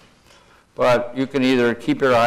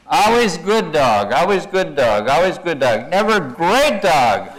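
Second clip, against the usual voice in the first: cartoon dog's complaint; 4 semitones higher, 4 dB louder than usual. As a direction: play up the dog's grievance, swelling on the last line. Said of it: "this dog says".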